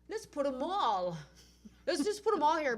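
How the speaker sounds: loud, mocking take on their plan; mock organizer plan. Mimicking the organizers moving booths, loud and mocking. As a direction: throw their words back, loud.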